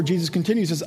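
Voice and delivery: usual speaking voice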